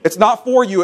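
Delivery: high, driving preacher's exhortation; preaching at full force. Acted. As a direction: press the point on the congregation. Preacher pressing the congregation.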